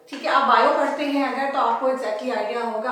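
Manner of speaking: ordinary talk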